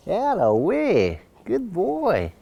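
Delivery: slow, stretched "That away" of praise; praising dog. Real, not acted. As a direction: coo approval at the dog. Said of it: "I'm gonna praise him a lot".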